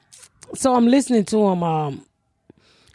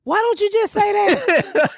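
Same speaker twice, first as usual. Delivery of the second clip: high, slow, exasperated question; can't believe he didn't just say it; 11 semitones higher, slower than usual. Real, not acted. Exasperated at friend.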